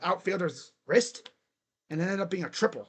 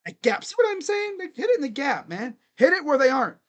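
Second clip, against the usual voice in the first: ordinary delivery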